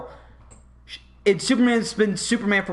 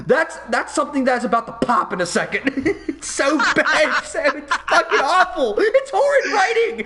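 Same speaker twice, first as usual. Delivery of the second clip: laughing rant; 6 semitones higher than usual. Real, not acted. Ranting, laughing at bad writing.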